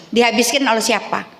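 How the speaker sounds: ordinary talk, nothing marked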